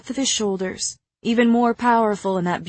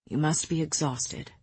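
softer, lower bedtime voice; 3 semitones lower, 7 dB quieter than usual. Acted. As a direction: say it gently, close and quiet. Rhys speaks softly in bed.

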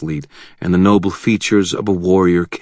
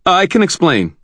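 blurted; 14 semitones higher, 6 dB louder than usual. Acted. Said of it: "I blurted".

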